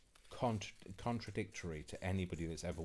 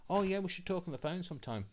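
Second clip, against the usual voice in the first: much higher put-on friendly chirp; mock breezy suggestion; 11 semitones higher, faster than usual. Imitating people suggesting a phone chat, mock breezy. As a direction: imitate someone casually proposing a call.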